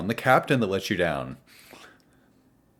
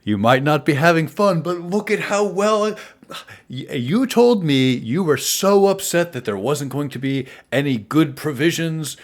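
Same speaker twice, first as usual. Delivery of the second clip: put-on higher, louder ribbing of an old friend; jovial teasing; 8 semitones higher, 7 dB louder than usual. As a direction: tease him heartily, building to the end. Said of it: "He punches you in the shoulder a little bit and says".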